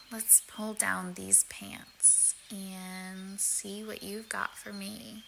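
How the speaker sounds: slow, hushed whisper. Acted. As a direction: breathe the words very softly. Intimate whisper in public.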